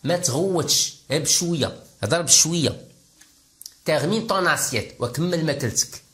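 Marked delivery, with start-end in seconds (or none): none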